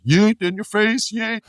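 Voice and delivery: high mock yeet shout; Jey Uso yeet. Host imitates Jey taunting the guard, high yeet call. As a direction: yell yeet like a taunting wrestler. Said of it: "Jey Uso's like".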